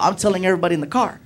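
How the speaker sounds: ordinary talk, nothing marked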